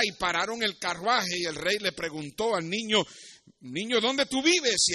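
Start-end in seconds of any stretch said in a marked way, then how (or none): 3.6-4.9 questioning king. Acted. The king stops his carriage to question a street child, raised voice. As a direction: ask the child in a higher, projected voice.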